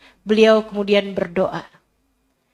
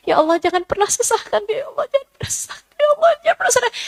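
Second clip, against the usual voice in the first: retelling a tearful prayer with a high, breaking voice; 14 semitones higher, much faster than usual. Retold or imitated. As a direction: ramp up into pleading, close to tears. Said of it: "Sampai dia nangis". (doa sambil menangis, suara tinggi)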